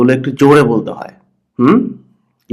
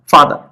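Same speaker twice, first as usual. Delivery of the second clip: ফা strong, দার light in father; stress on first syllable. Shown as a demonstration. Teacher putting the two parts together, first-syllable stress. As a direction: lean on ফা and drop দার. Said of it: "জোরে হয়".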